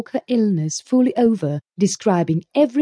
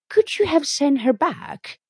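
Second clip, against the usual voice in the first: gossipy neighbour voice; 8 semitones higher than usual. A narrator's reading. Rachel voiced in story.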